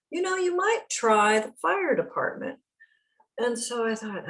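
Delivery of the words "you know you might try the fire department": lightly voiced helpful librarian, higher; a hint of a polite librarian pitched up; voicing the librarian.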